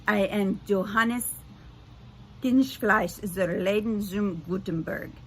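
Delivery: put-on slow, proud German accent introduction; thick German accent. Gutenberg introduces himself to the teens, thick German accent. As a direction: announce your full name like a grand German. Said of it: "a thick German accent".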